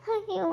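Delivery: short high sobbing cry of ayyo; a sudden sob breaking through. Acted. Wife sobbing as she gives up on the house.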